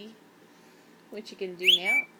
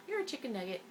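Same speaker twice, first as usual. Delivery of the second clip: usual speaking voice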